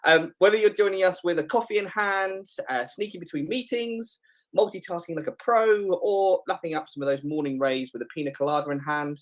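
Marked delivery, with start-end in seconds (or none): 0.0-7.9 high, upbeat host patter; game show host. Real, not acted. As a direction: bounce it like a TV host. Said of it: "You sound a bit Bruce Forsyth".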